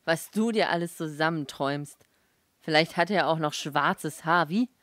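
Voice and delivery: loud, derisive disbelief; mocking. A narrator's reading. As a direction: ridicule the dreamer, loudly. Husband mocks her dream.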